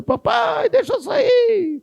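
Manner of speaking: put-on high pleading little boy begging "Papai"; crying child. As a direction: beg like a scared kid in the dentist's chair. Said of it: "Ele gritando".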